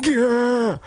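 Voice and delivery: high strangled "gah"; groin-kick yelp. Retold or imitated. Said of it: "when you're properly kicked in the scronks".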